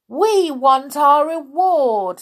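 high, loud, drawn-out demand; demanding shout. A narrator's reading. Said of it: "suddenly shouted someone".

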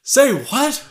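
high, loud "say what"; a big shocked double take; shocked reaction. Pretend. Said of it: "reaction shots where you go".

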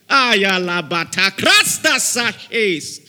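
slow, loud tongues; speaking in tongues. Real, not acted. Preacher bursts into tongues before the crowd, loud and slow. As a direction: stretch out the tongues with force.

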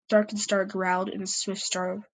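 usual speaking voice

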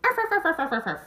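high rapid arfs; high yappy arfs. Acted. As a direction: yap like a toy dog. Small dog barking.

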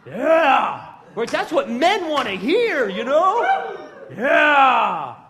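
loud, high, laughing yeahs; excited shouting. Real, not acted. Preacher hyping the men up, loud laughing shouts. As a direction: whip the crowd up with a big yeah.

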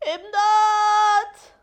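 high, loud, drawn-out shout; cry for help. Acted. Friend in trouble while Maya notices nothing, high cry for help. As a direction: yell for rescue, stretching the word.

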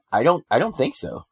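usual speaking voice

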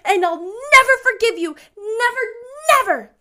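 put-on high, loud child's tirade; furious, tearful indignation, very high; vehement. A narrator's reading. Anne tells Rachel how much it hurt, vehement. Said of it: "she repeated vehemently".

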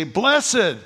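"Blessed" punched high and loud with a falling finish; emphatic Blessed. Shown as a demonstration. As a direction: hit "Blessed" hard, then drop. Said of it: "very emphatic".

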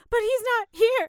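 high, loud whiny panic; anxious protest. Retold or imitated. To the nurses, with her boyfriend gone, a high, loud scared whine. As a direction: plead it, scared of the shot alone.

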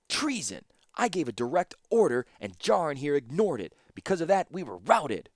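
sputtering rage. A narrator's reading. Ashnod to Mishra, blaming Jarin, in a sputtering rage. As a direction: spit out the accusation in a rage. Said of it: "She was sputtering in a rage".

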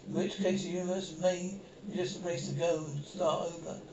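ordinary talk

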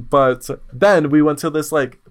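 normal talking voice

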